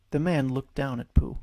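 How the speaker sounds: ordinary delivery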